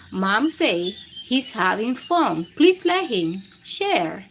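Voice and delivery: put-on lively read-aloud to children; animated storybook narration; picture book reading. A narrator's reading. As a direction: read it like bedtime story time for kids.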